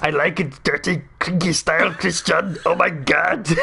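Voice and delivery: mock passion in an Austrian accent; Arnold Schwarzenegger. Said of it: "it was just Arnold Schwarzenegger instead".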